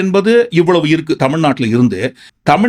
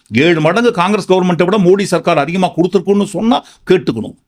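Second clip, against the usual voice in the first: ordinary delivery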